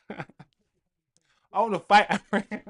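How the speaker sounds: very slow, laughing through the words; cracking up at his own joke. Real, not acted. Streamer to chat, joking about fighting Amrim, laughing.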